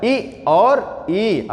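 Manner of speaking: इ and ई stretched out very slowly; very slow vowel demo. Shown as a demonstration. Teacher stretches the front vowels for students. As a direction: draw out इ, then the long ई. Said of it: "अग्र स्वर हमारा कौन कौन सा होता है?".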